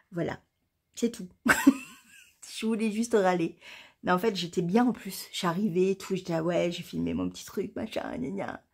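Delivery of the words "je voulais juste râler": normal talking voice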